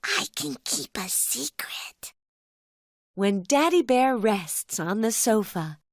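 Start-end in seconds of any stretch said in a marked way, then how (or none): 0.0-2.1 soft secretive cub whisper. Acted. Little Brown Bear promising dad to keep the surprise, whispered. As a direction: breathe it like a secret only dad may hear.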